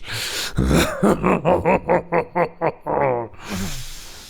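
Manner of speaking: high mocking villain laugh; evil laugh. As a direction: laugh him off with scorn. Villain mocks new challenger.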